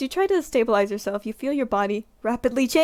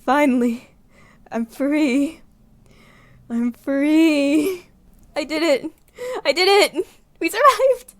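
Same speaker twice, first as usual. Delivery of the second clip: rising, louder joyful cry; triumphant relief; 5 semitones higher, 3 dB louder, slower than usual. Acted. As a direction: celebrate surviving, building to a shout.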